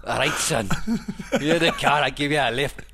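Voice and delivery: a poor attempt at a Scottish accent, laughing; mock Scottish accent that drifts Irish; bad Scottish accent. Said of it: "That's, that's Irish, [name]".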